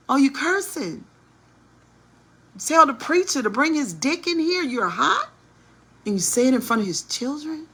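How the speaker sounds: high-pitched incredulous questioning; acted disbelief. Acts out shocked disbelief.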